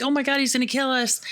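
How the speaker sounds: fast, high mock panic; panicked yelling. Couple yelling in panic as truck rams them, fast high mock panic. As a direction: blurt it out in terror. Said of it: "They're both yelling".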